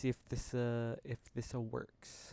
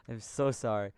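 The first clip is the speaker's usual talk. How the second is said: ordinary delivery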